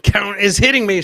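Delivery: ordinary talk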